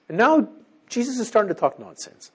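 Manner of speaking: ordinary talk, nothing marked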